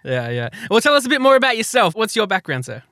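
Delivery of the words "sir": ordinary talk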